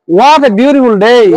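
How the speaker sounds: "What" swung up, "day" dropping at the end; slow model sentence, voice arching down onto "day"; rise-fall intonation. Shown as a demonstration. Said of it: "Yan te abas uri anno pore". (আওয়াজ তুলে তারপর নামানো)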